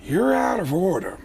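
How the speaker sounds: slow, rising impression of an outraged actor; Al Pacino. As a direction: build a hammy movie-star rant. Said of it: "they cut to me as Al Pacino".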